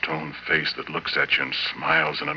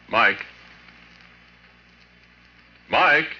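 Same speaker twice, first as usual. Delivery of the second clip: calling a name louder and higher; 8 semitones higher, 6 dB louder than usual. Acted. Husband calling his creature wife, rising call. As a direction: call her in from another room.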